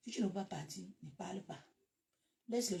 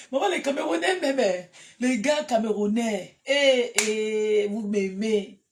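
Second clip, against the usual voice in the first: excited calling out; 3 semitones higher, 13 dB louder, slower than usual. Real, not acted. Loudly addresses Cameroonian fans.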